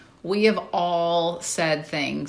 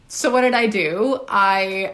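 normal talking voice

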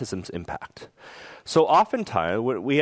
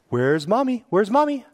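playful sing-out, rising higher on the repeat; peekaboo parent; 8 semitones higher, 3 dB louder than usual. Acted. Parent playing peekaboo with a toddler, playful and raised. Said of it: "might be like a peekaboo game".

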